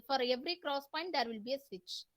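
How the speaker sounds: usual speaking voice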